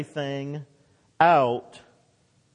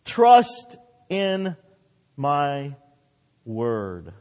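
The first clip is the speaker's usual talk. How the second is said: word by word, with weighty pauses; God's solemn charge; 2 semitones lower, 4 dB louder, much slower than usual. Retold or imitated. Preacher closes God's exhortation.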